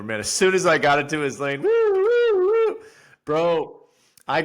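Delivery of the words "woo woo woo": high, loud siren wail; police siren imitation. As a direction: mimic the cop siren whooping.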